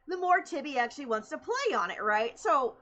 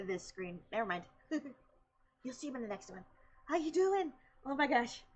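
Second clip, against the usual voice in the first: normal talking voice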